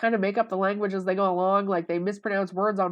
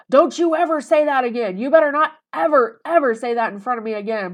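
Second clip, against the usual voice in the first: mock furious scolding, louder and higher; retold raging; 5 semitones higher, 7 dB louder than usual. Ex-boyfriend raging at her over awesome sauce, mock furious. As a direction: rage at her like the outraged ex. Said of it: "just raging at me".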